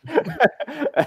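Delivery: laughing at own yell; high burst of laughter. Real, not acted. Laughs after his own yell.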